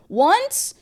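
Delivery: high rising once; incredulous. Retold or imitated. Girlfriend doubts his one date, high incredulous rise. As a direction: fling it up in disbelief.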